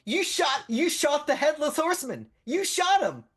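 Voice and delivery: high, loud, gushing shout; awestruck fan. Acted. Fans tell the hero what he did, awestruck shouting. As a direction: yell it in starstruck amazement. Everyone hails the shooter.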